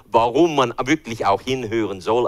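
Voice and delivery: ordinary talk, nothing marked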